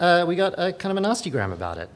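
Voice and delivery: normal talking voice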